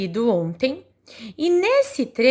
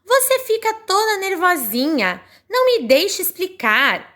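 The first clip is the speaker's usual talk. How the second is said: voicing Telim; 7 semitones higher, 3 dB louder than usual. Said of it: "o Telim fala assim".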